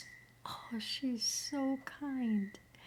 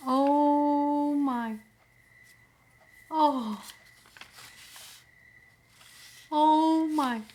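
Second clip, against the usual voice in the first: loud, high cry of delight; overwhelmed gasp; 5 semitones higher, 8 dB louder, slower than usual. Real, not acted. Finding a touching gift in the mail, loud high cry of delight. Sudden reaction to opened gift.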